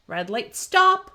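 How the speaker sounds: ordinary delivery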